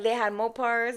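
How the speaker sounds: ordinary talk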